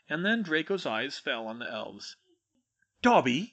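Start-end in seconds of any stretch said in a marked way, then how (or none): 3.0-3.5 sharp, loud cry of disbelief, pitched up at the end. A narrator's reading. Draco spots the elf, loud rising disbelief. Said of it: "he said in disbelief".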